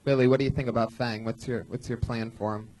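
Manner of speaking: normal talking voice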